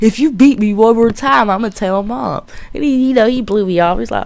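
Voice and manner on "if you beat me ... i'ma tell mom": put-on higher, louder whiny child; imitating young self tattling; mock kid threat. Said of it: "I straight up was like".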